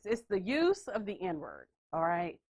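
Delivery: normal talking voice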